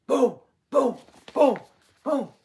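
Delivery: loud booming footstep sounds; giant footsteps. Shown as a demonstration. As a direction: stomp each boom out loud. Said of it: "Can you imagine the forest".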